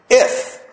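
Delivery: If punched high; stress on if. Shown as a demonstration. Preacher stressing If to the congregation, punched high. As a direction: hit the word If hard to start. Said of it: "I want to stress the word if".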